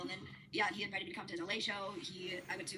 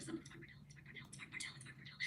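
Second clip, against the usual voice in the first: hushed, unvoiced repeating of the name, fading softer; whispered insistence; 13 dB quieter than usual. [name] urgently whispering the name at the party, hushed. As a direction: murmur the name over and over under your breath.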